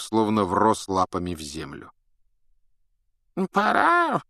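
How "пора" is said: put-on high, slow creaky old man; old prospector. A narrator's reading. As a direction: croak it like a frail old man. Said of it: "скрипучий голос старца".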